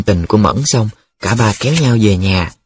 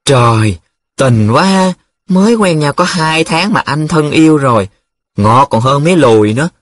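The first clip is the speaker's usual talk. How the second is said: higher, teasing marvel; gushing admiration; 5 semitones higher, 3 dB louder than usual. Acted. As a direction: coo over a friend's love letter. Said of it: "Nhiệm đã hít hà bình luận". (hít hà trầm trồ)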